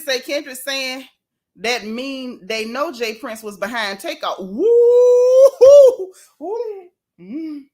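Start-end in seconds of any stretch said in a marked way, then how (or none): 4.4-6.1 long, high, loud "woo"; piercing sustained whoop of amazement. Real, not acted. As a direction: let out a drawn-out high whoop. Startled reaction to the lyrics.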